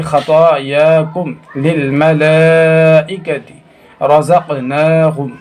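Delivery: slow मलाइकति with a very long held laa; joined-up reading. Shown as a demonstration. Teacher demonstrating joined words, slow recitation with मलाइकति drawn out. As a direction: recite slowly, stretching long vowels in खतायाकुम and मलाइकति. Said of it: "मिलाएंगे तो यूँ पढ़ेंगे".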